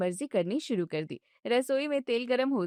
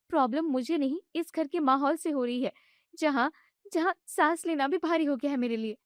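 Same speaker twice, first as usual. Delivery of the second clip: higher, indignant daughter-in-law voice; young wife protesting; 4 semitones higher than usual. Acted. Bride objects when mother-in-law shuts off the gas, indignant and high. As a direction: push back, hurt and annoyed.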